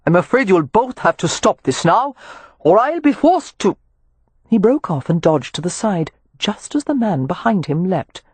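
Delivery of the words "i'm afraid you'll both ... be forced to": reasonable appeal rising to a cut-off warning; composed character voice, lifting at the end; excessively reasonable. A narrator's reading. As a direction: reason with brawlers, then break off higher. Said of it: "excessively reasonable tone, sounding relaxed".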